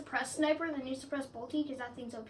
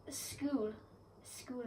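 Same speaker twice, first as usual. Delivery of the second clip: odd accent on "School", trailing softer; accidental odd accent; 8 dB quieter than usual. Real, not acted. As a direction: repeat "School" in a slightly strange accent. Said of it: "brought that accent".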